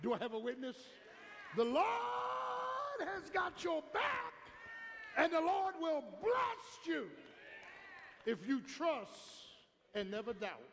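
high, loud, slow preacher's shout; whooping climax. Real, not acted. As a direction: hold out the words to the rafters.

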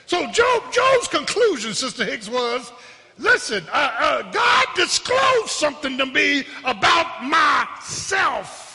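preacher building to a high shout; fervent preaching. Real, not acted. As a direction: drive the revelation home to the congregation. Preaches the conclusion at force.

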